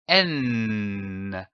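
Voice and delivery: a long hummed "N" held through the nose, pitch gliding down; sustained N sound. Shown as a demonstration. Teacher showing pupils the sound N, long humming "N". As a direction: hold "N" as a steady nasal hum for the class. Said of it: "avec le son de la consonne".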